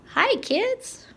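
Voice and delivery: high, tiny puppet voice for the bug; squeaky bug voice. Pretend. Teacher voicing a toy bug greeting the kids, squeaky high. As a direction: pipe it up like a little bug saying hello.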